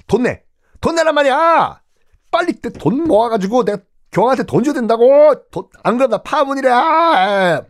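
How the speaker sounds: 높고 크게 몰아붙이는 외침; 귀족들을 들볶듯 다급하게 소리쳐; 다급한 고함. Acted. 귀족에게 돈 독촉하는 왕. (파문이 두려운 헨리 삼 세가 귀족들에게 돈 내라며 고함)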